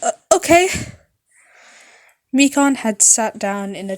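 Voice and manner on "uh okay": high, loud panicked apology; high anxious girl voice, loud; nervous nurse. Acted. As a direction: gush out the apology in a fluster. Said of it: "Mikan blushed slightly".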